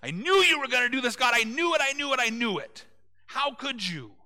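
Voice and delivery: high, loud, fast exasperated whine; whiny complaint to God. Retold or imitated. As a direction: complain to God like a sulking prophet. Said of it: "he's praying and he goes".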